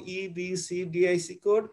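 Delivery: ইবিসি, ডিআইসি read as two separate chunks; split into parts. Shown as a demonstration. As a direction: break ইবিসি and ডিআইসি apart with a pause. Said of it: "আলাদা আলাদা ভাঙে ভাঙে বলবো". (ইবিসি আর ডিআইসি আলাদা করে ভেঙে পড়া)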